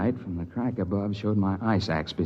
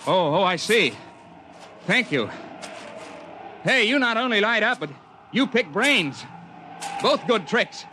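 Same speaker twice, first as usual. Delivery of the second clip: narrator's younger self in the crevasse; 13 semitones higher than usual. Acted. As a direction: shout up the ice wall, cheerful and relieved.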